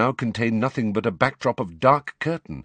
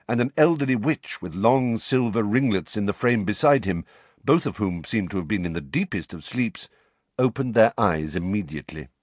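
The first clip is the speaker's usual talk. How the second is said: plain reading voice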